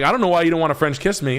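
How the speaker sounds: high, rushed imitation of a bar flirt; mock pickup line. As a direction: act out a hurried, high-pitched come-on. Acting out his bar pickup line.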